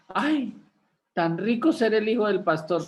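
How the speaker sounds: a hint of a church member envying the pastor's son; mock envious gossip. People imagining the pastor's life.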